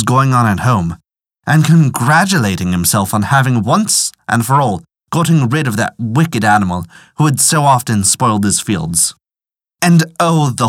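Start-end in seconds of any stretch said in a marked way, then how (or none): none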